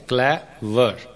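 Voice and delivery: "Clever" split into two beats, clay and वर; syllable by syllable. Shown as a demonstration. Teacher showing two syllables, "clever" broken as clay, वर. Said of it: "clever में दो syllables हैं".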